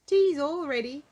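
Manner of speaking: high, loud and jolly; high, loud jolly call to tea; cheerful announcement. Acted. Mister Greedy announces tea.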